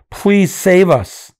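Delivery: slow, high pleading cry; begging for help. Retold or imitated. Imitating hostages begging the leader to save them, high and slow. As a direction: plead to be saved, drawing it out.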